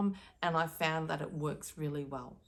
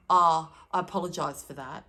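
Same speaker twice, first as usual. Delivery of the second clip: louder, breezy brush-off apology; insincere apology; 9 dB louder than usual. Retold or imitated. Someone dismissing a complaint, a loud hollow apology. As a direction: toss off the apology without meaning it. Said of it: "I'm sure you've experienced that tone".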